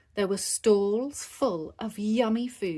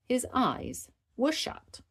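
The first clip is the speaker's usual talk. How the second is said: ordinary delivery, nothing marked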